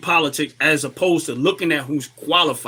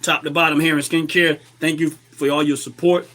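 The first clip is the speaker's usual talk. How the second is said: ordinary talk, nothing marked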